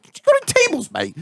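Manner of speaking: very high squeaky imitation; cheeky mock request. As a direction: beg cheekily in a high mocking voice. Imitates own cheeky booking text.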